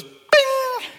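very high, far-off "Bing!" like a magic chime; ping it out like a conjuror; playful bing. Retold or imitated. God showing off spring flowers, playful chime. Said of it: "God just goes".

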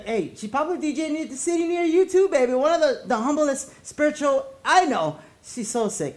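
usual speaking voice

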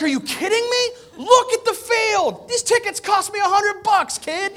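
dad yelling at his son in the stadium; mock outrage. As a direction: blow up in comic disbelief.

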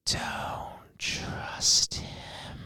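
very slow, drawn-out creepy whisper; eerie whisper. Acted. Older self warns the speaker from inside the box, slow eerie whisper. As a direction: whisper it slowly, like an ominous warning. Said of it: "And they whisper to me".

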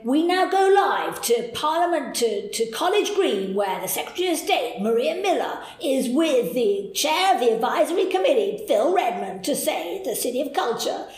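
put-on lightly done American newsreader accent. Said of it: "They didn't really say it in an American accent".

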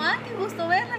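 imitating a boss's fake-friendly hello; mock sugary greeting. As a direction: gush like you're delighted to see her.

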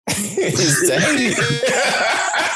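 cracking up mid-sentence, high and slow; real laughter breaking up the words; laughing. Real, not acted. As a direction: tease a friend while breaking into laughter. Cracks up teasing his friend.

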